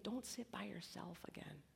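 soft unvoiced whisper; hushed inner-voice whisper; still small voice. Shown as a demonstration. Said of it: "still small voice".

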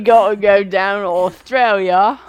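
loud mock Australian accent. Cohost riffing on Australia, loud mock Australian accent. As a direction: belt out a jokey Aussie line. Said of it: "How many accents can you do?".

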